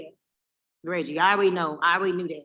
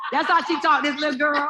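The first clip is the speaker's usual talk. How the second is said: laughing tease; 5 semitones higher, 5 dB louder than usual. Real, not acted. Teasing over "little girl.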